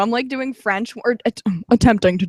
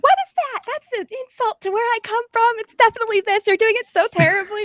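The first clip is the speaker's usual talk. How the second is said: high-pitched mock-outraged complainer; indignant nitpicker; 11 semitones higher than usual. Mocking the one viewer who hates the accent, high mock indignation. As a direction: imitate an affronted accent purist, voice pitched way up. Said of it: "that one shithead that's like".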